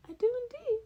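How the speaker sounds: small pleased professor squeak; high Nezu voice. Acted. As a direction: chirp it back, delighted. Nezu answers Denny in story.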